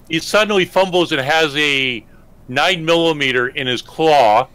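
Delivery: usual speaking voice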